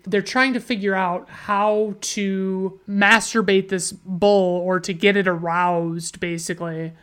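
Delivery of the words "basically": normal talking voice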